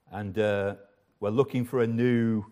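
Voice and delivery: usual speaking voice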